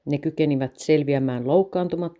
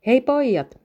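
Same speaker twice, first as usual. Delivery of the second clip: higher and louder call across the room; 8 semitones higher, 5 dB louder, slower than usual. A narrator's reading. Mother calls the boys from the living room doorway, calling out. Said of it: "huikkasi Topin äiti olohuoneen ovelta".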